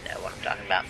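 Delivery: quick, whispery aside to yourself; under-the-breath rapid mutter. Real, not acted. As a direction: mumble it fast, almost no voice.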